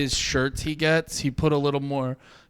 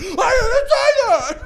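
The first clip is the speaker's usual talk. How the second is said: high, loud, drawn-out wail through laughter; exaggerated exasperated cry, nearly laughing; mock outburst; 6 dB louder, much slower than usual. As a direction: shriek it like a hammed-up meltdown. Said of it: "this close to being like".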